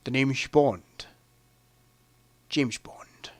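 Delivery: slow, low impression with "Bond" and "James" slurred towards "sh"; Sean Connery. Showing off a best impression of the film's star, slow and shushing. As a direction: draw it out softly with shushed s sounds. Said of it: "Or as Sean Connery would say".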